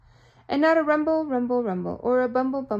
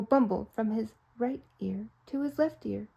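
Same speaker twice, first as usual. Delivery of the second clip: usual speaking voice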